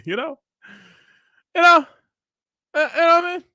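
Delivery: squeaky. Real, not acted. Laughing high squeaky aside.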